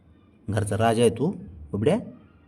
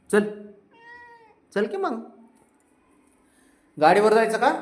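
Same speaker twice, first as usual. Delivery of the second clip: high excited invitation; high luring; 11 semitones higher, 4 dB louder than usual. Real, not acted. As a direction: tempt him to go out. Luring pet outside. (बाहेर जाण्यासाठी उंच आवाजात लालूच)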